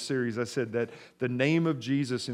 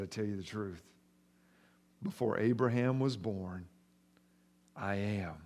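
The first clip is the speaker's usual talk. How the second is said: much softer, grave and low; hushed, reverent declaration; solemn hush; 4 semitones lower, 6 dB quieter than usual. Acted. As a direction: let it land quietly. Jesus' solemn claim.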